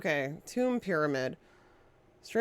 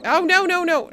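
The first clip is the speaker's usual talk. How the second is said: high, loud panicked no; sudden panic; alarmed; 9 semitones higher, 5 dB louder than usual. Real, not acted. Game mishap.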